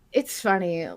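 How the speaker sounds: ordinary talk, nothing marked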